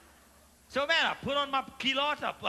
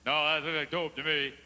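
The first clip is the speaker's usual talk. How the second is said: lower steward voice; 9 semitones lower than usual. Retold or imitated. Steward answers uncle.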